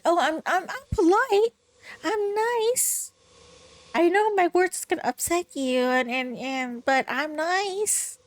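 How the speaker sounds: high, sing-song sugary imitation; mock fake polite. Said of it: "you're trying to be fake polite".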